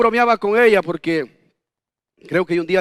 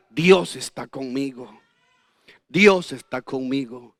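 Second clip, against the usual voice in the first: lento y suave, con fe tranquila; confianza serena; 6 semitones lower, 4 dB quieter, slower than usual. Acted. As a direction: dilo despacio, como una certeza íntima. Creyente repite su fe en el desierto. (afirmación lenta y suave de fe)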